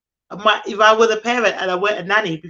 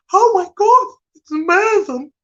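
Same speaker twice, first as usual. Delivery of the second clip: high, loud imitation of delight; delighted gushing; 11 semitones higher, 5 dB louder than usual. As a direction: gush like a thrilled mum. Mum boss's delighted reaction, retold.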